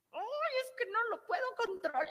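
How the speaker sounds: high, plaintive client voice; a client whining to her therapist, high and helpless; whiny client. Retold or imitated. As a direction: play a helpless patient complaining. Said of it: "llega a terapia y me dicen".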